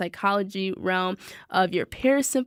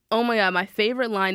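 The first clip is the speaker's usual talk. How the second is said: ordinary delivery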